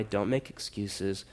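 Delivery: ordinary delivery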